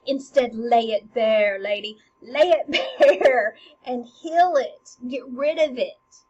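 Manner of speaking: loud, emphatic acted plea; forceful urging. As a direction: press the lady hard to act. Hamlet urges his mother forcefully.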